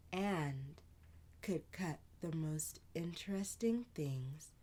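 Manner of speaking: whispered narration. A narrator's reading. Narrator telling a child's bedtime tale, whispered. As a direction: tell it in a hush, close to the ear.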